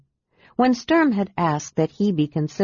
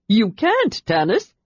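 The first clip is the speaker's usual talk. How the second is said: kender crying out; 5 semitones higher than usual. A narrator's reading. Kender pleading with Tanis not to leave. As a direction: cry out to stop him leaving. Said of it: "Tasselhoff cried".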